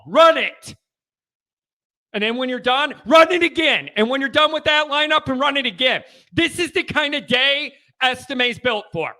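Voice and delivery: loud, high-pitched fired-up shouting; worked up. Real, not acted. Host urging his team to keep running, worked-up shout. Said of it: "I'm getting worked up".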